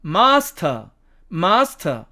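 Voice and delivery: British "master", long ah, silent r; British demo of "master", r dropped; British accent. Shown as a demonstration. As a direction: say "master" as British "mahs-tuh". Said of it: "영국식 발음으로 가면".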